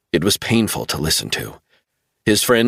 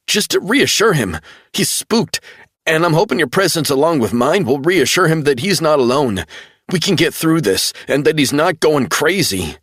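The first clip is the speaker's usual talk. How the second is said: much higher, urgent pleading; desperate insistence; 9 semitones higher than usual. A narrator's reading. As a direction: make him believe you. Travell pleading.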